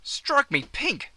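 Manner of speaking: high, slow exclamation of amazement; astonished. Acted. Mate seeing Brucey's bush clobber, hammed-up astonishment. Acted astonishment at mate's outfit.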